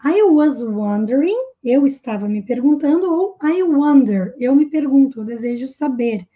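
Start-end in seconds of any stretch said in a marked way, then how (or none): none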